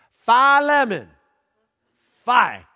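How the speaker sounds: Phi lemon, loud and high, fie like the Greek letter; frat boy. Shown as a demonstration. Pastor mocking frat boys for the congregation, loud high shout. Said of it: "say it like the frat boys or sorority girls".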